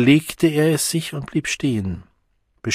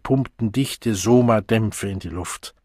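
ordinary delivery, nothing marked

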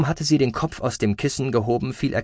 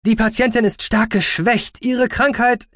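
high, loud, insistent doctor; calling out; 13 semitones higher, 6 dB louder than usual. Acted. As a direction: protest officiously. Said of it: "rief Aspartamin".

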